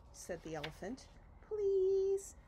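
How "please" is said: high, loud pleading please; begging. Acted. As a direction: beg him outright, pitched high. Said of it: "begged the bird".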